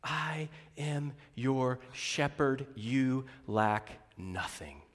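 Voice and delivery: slow, soft, calm divine voice. Acted. God answering the world's shouting, slow and calm. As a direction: say it gently, unhurried, settling them. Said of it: "God says calmly".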